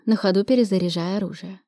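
usual speaking voice

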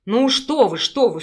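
loud, teasing mock protest; amused smirk. A narrator's reading. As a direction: toss it off with a smirk. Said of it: "с усмешкой выдал мужчина".

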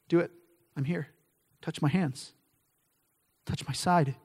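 soft, low, gentle invitation; hushed, gracious reassurance; compassionate. Acted. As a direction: offer it tenderly, with pauses between phrases. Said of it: "in his good, compassionate grace".

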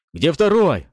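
loud, high, vicious yell; angry shout. A narrator's reading. Gunmen demanding the second man, angry shout. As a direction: bark it out angrily, high. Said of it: "раздались их злобные крики".

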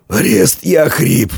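strained, high-pitched hiss. A narrator's reading. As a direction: force it out of a hoarse throat. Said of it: "прошипел Фока".

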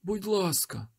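high desperate pleading; frightened high begging; pleading again. A narrator's reading. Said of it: "попросив той чоловік".

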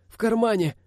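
very high, strained answer; pained cry. A narrator's reading. Wounded driver tells where keys are, high pained cry. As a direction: cry it out while hurting.

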